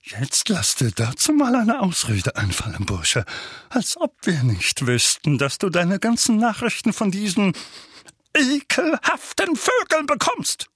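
strained accusation climbing high; building from gloating challenge to high disgust; accusing uncle. A narrator's reading. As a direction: corner the boy scornfully. Uncle triumphantly accuses nephew.

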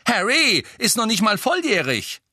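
high, loud, shrill outburst; shrill protest. A narrator's reading. Twin objecting that Harry is underage, shrill. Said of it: "mit schriller Stimme".

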